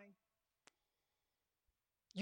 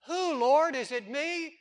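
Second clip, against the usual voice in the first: higher, louder startled question; anxious disciples; 8 semitones higher, 13 dB louder than usual. Acted. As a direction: ask like you're afraid it's you.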